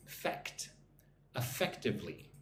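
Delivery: "Fect" alone, then "Effectively" stressed on FECT; stress on second syllable. Shown as a demonstration. Teacher showing learners where emphasis goes, stress on second syllable. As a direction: say "Fect", then eh-FECT-ively, softly and deliberately. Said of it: "Put the emphasis on the second syllable".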